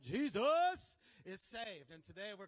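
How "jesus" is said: loud, drawn-out shout of the name; shouted exclamation. Real, not acted. Preacher calling on the Lord's name, shouted. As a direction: cry it out big and long.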